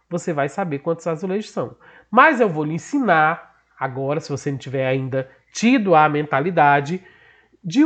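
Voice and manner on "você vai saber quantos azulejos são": usual speaking voice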